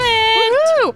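high excited woohoo; cheering. Real, not acted. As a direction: cheer it out, thrilled. Cheering before big announcement.

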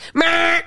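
high, blaring rejection noise; loud mock buzzer. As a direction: blare a short dismissive cry. Buzzer-like cry after "Next.

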